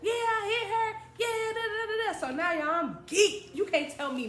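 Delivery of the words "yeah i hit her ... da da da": high, excited retold praise; gushing little sister. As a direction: squeal thanks at a hero brother, playfully. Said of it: "hugging on him like".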